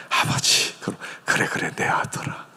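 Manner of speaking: soft whispered father's embrace; tender whisper. Retold or imitated. Father embracing his repentant son in a soft whisper. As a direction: breathe it gently to a returning son.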